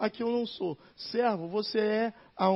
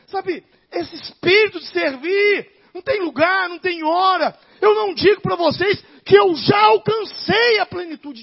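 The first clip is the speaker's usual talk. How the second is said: loud, high, slow preacher's cry; fiery pulpit climax, building to a shout; full-force preaching; 8 semitones higher, 13 dB louder than usual. Acted. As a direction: shout it out to the congregation.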